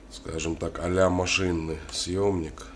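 ordinary talk, nothing marked

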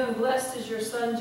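usual speaking voice

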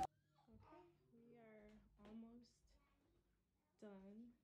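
hushed whisper, barely voiced. Real, not acted. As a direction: keep it to a soft whisper. Quiet talk near sleeping kids.

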